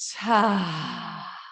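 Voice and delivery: long, open sighing exhale; demonstrated sigh of release; audible sigh. Shown as a demonstration. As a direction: breathe out in one big sigh. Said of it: "And sigh it out".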